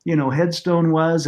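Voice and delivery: ordinary talk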